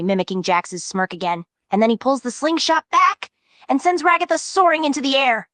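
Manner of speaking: ordinary talk, nothing marked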